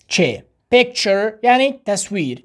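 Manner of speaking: normal talking voice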